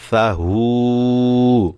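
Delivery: সা হু chanted very slow with held vowel; long vowel pull. Shown as a demonstration. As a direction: stretch সা হু as Quran recitation. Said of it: "এখানে এক আলিফ টান হবে".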